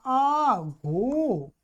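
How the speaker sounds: आघोटत with long, stretched vowels; slow model form. Shown as a demonstration. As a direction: draw out आघोटत syllable by syllable. Slowly models new form.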